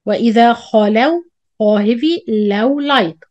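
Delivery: usual speaking voice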